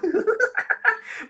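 big high burst of laughter; host losing it at the mayor bit. Real, not acted. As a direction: crack up at the joke. Cracking up at joke.

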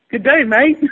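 high-pitched mock Aussie greeting; cartoonish high Australian accent. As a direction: attempt English and land on Australian. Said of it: "That's Australian".

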